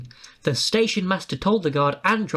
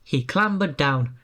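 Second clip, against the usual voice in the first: usual speaking voice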